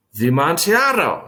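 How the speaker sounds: a startled cry of the wine's name; astonished exclaim. Acted. Friend exclaims to narrator in astonishment, startled cry. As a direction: blurt it out in surprise. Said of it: "ejaculated my friend".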